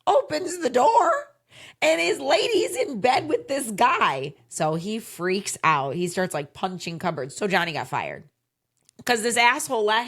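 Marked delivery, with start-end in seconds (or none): 0.1-4.3 higher, louder, almost crying out the twist; dramatic reveal. A narrator's reading. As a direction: build it up like the juiciest gossip.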